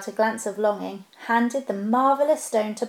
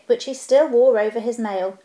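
ordinary delivery, nothing marked

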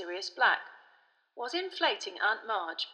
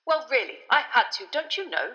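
higher, loud, huffy minister; irritable official; 5 semitones higher, 7 dB louder than usual. A narrator's reading. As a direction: defend yourself with tetchy impatience. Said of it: "said an irritable Fudge".

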